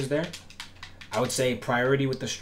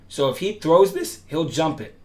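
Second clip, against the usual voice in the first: excited, voice breaks upward; real crack on the high note; 5 semitones higher, 9 dB louder than usual. Real, not acted. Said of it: "My fault for the voice crack".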